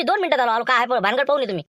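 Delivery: ordinary talk, nothing marked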